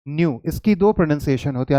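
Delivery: usual speaking voice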